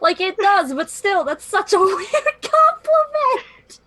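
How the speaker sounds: high, laughing disbelief. Real, not acted. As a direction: giggle through the words. Amused disbelief, laugh heard.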